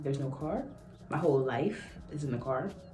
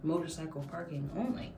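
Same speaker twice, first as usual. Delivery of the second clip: softer, stunned repeat rising at the end; disbelieving echo; 6 dB quieter than usual. Retold or imitated. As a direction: echo it back like you can't believe it. Echoing officer in disbelief.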